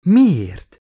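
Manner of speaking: a sharp, loud, high disbelieving cry; incredulous. A narrator's reading. Stunned doctor cries out her question at the android, loud with disbelief. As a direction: blurt it out in shock. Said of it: "képett el Elizabeth hitetlenkedve".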